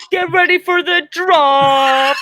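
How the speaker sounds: slow mock hype, giggling; hammed-up priest. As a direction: hype up the baptism like a DJ, laughing. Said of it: "I'm like".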